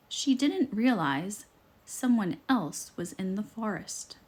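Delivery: plain reading voice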